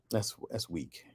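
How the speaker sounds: normal talking voice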